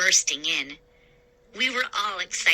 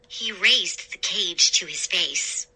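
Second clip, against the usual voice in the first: ordinary delivery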